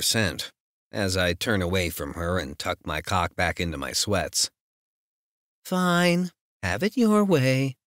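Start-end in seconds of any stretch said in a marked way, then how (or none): 5.6-7.8 light, higher sing-song tease. Acted. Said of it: "Her voice is so light and sing-song".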